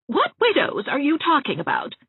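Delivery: high, sharp demanding question. A narrator's reading. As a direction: Mrs Lynde insisting on an answer, pitched high. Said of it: "demanded Missus Lynde".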